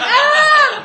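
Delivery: high, loud shocked shriek; mock scream. Kids shrieking at a bloody nose, mock scream. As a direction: let out a hammed-up scream. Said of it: "everyone was like".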